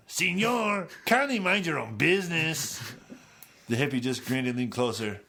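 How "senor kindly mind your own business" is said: higher, louder annoyed foreign man; irritated accented man. Acted. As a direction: snap back with a foreign accent. Accented man snaps at hippie.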